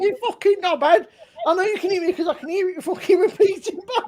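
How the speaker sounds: retold angry yell. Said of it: "He's fucking screaming down the phone".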